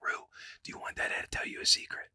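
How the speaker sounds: soft unvoiced whisper to a toddler. Dad whispering to daughter Rue, hushed whisper. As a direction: lean in and whisper the secret. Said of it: "like whispering to them".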